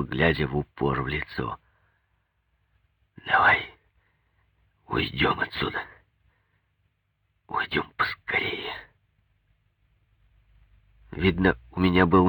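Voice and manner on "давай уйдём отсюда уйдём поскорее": whispered, urgent plea to leave; near-voiceless, intimate urging; hushed plea. A narrator's reading. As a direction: plead under your breath, softly. Husband urges wife to leave, intimately.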